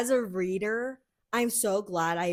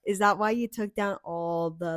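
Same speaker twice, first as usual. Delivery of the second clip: normal talking voice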